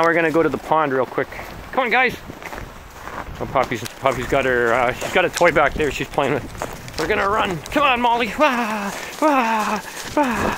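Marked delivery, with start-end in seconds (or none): 1.7-10.6 excited calling. Real, not acted. Calling dogs to run.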